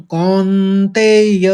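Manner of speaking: "कौन्तेय" में कौन पर झंकार; ringing nasal kaun. Shown as a demonstration. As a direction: stretch "कौन्तेय" so कौन resonates. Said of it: "लगना चाहिए कि झन्न हो रहा है कौन में".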